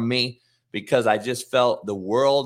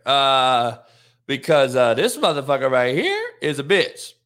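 smart-ass taunt; 2 semitones higher, 3 dB louder than usual. Retold or imitated. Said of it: "in the smart-ass way that I do".

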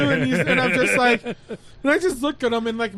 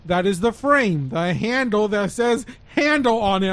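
slow, lower, spelled out; slow condescending explanation; 3 semitones lower than usual. Retold or imitated. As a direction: explain it like to a child. Paramedic explaining slowly.

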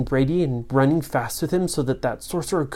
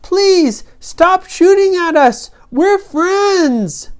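high, loud drawn-out plea; pleading shout in a high voice; calling out; 7 dB louder, much slower than usual. A narrator's reading. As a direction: call out pleading across a distance. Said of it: "Ryan called out and he said".

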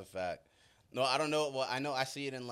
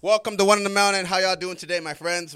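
host intro; 7 semitones higher, 14 dB louder than usual. Acted. Opens the show to viewers.

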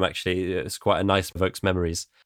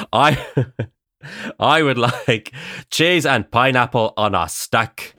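slow, hammed-up Northern Irish comedy accent; 2 semitones higher, slower than usual. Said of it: "one of them's Northern Ireland".

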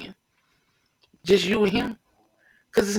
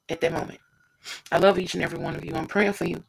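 normal talking voice

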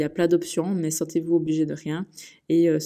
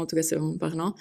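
ordinary delivery

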